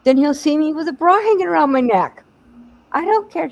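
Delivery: loud defiant retort; indignant teen. Retold or imitated. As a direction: fire it back defiantly. Teen arguing with mom, loud.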